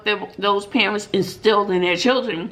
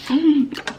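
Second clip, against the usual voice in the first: short laugh; 6 semitones higher than usual. Real, not acted. Laughs at own joke.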